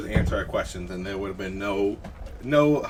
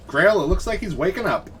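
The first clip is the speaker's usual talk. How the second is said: high, singsong call-out; calling over; 8 semitones higher, 4 dB louder than usual. Acted. Player tells Grail the bandit is waking, calling over. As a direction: call it lightly to a companion.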